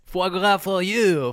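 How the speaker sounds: high, slow, hammed-up French; drawn-out mock French flourish; French accent. Mocking Macron, French flourish.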